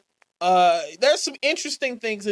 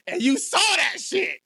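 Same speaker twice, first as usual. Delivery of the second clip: higher, shouted disbelief; incredulous shout; 7 semitones higher than usual. Real, not acted. To his co-host about the promo, shouted disbelief. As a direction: yell it in outrage.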